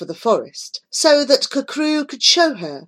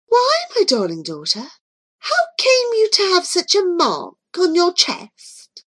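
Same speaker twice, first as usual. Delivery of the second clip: exclaiming; 10 semitones higher than usual. Acted. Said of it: "she exclaimed".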